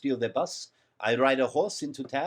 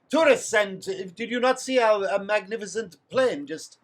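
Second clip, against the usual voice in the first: put-on French accent; 5 semitones higher, 5 dB louder than usual. Player speaks in character.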